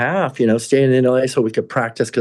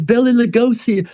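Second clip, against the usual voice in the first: awed name-drop; 11 semitones higher than usual. Retold or imitated. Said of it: "And he goes".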